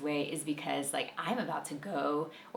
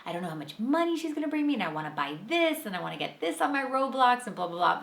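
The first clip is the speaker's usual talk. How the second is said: higher, eager kid voice imitating the son; excited child; 6 semitones higher than usual. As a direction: rattle off a kid's wish list with excitement. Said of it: "he's like".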